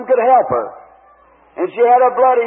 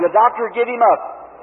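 ordinary delivery

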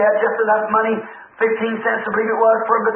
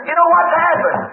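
higher, urgent call to the preacher; excited news-bringer; 7 semitones higher than usual. Retold or imitated. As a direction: burst in with big news. Acted excited news, repeated.

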